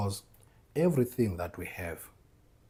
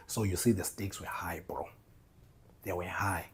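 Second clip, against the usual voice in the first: gefluisterd, met nadruk; whisper; 3 dB quieter than usual. Real, not acted. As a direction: fluister het als een geheim. Dramatic whisper to listener.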